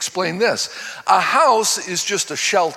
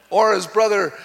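usual speaking voice

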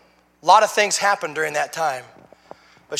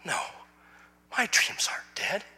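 soft unvoiced whisper; a hushed voice from nowhere; quiet whisper; 12 dB quieter than usual. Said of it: "there was a voice that said".

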